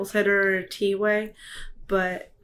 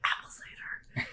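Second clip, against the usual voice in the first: whisper; 13 dB quieter than usual. Retold or imitated. Quoting own tasting reaction.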